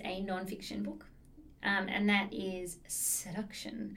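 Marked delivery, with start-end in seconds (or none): 2.9-4.0 slow, breathy whispered title; seductive purr. Real, not acted. Reading out a racy book title to the hosts, seductive purr. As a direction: purr the word out slowly and suggestively. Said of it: "have to say it in that way".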